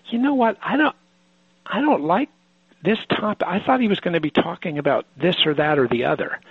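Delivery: high, whiny mock complaint; judgmental inner voice. Teacher voicing a listener's judging thought, mock whiny. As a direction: play the bored, judging listener. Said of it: "you start thinking".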